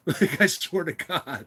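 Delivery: slow, laughter-choked oath; weak from laughing; crying with laughter. Real, not acted. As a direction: drag the words out through real laughter. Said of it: "crying, like, from laughter".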